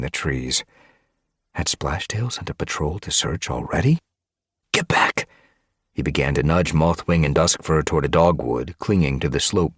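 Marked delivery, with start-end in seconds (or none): 4.7-5.3 whispered urgent command. Acted. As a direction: hiss it low so no one hears. Hiding from a patrol.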